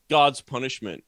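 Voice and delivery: ordinary talk, nothing marked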